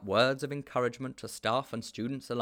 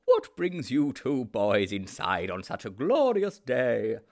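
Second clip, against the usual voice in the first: louder, slow beaming headmaster; jovial headmaster; 3 semitones higher, 6 dB louder, slower than usual. A narrator's reading. The headmaster greets the two boys, jovial and slow. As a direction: boom it out with a beaming smile.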